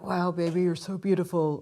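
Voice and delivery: put-on a lightly done, shaky American accent for a smitten man; bad American accent. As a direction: imitate an American man admiring his girlfriend, in a poor American accent. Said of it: "sorry about my shit accent".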